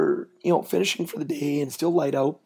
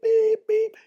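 imitated reversing beep; 4 dB louder than usual. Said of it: "You hear the beep".

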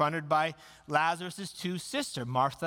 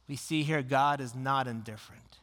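ordinary talk, nothing marked

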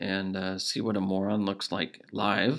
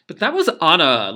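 ordinary talk, nothing marked

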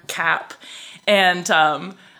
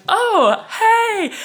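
very high, loud, drawn-out hello; forced surprised greeting; 8 dB louder than usual. Retold or imitated. She greets the unwelcome man on the platform, forced surprise. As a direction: overdo the friendly surprise to cover discomfort.

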